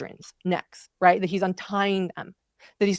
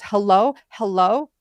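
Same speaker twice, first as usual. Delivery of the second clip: imitated operator calling; 2 semitones higher, 5 dB louder than usual. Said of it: "And the operator goes".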